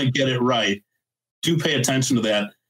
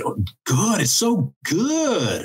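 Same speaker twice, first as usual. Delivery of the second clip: high, very slow, drawn-out gushing "good"; emotional English emphasis; 6 semitones higher, much slower than usual. Shown as a demonstration. Showing learners the English emphatic tone, slow and high. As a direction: stretch "good" way up like you mean it. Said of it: "if you wanna say that something's really good, you'll say".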